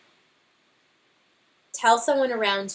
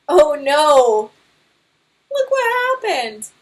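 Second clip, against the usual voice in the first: high, loud hammed-up gasp of alarm; mock alarm; 7 semitones higher, 10 dB louder, slower than usual. Teacher reacting to Papa Bear's mishap, hammed-up alarm. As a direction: pretend shock for the class.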